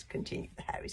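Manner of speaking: ordinary delivery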